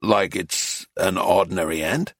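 low, soft American tourist; puzzled American man. Acted. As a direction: ask low, with a questioning rise. Reader plays puzzled American man.

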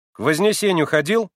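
stern and insistent. Acted. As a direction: interrogate him sternly. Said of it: "строго и настойчиво вдруг спросил".

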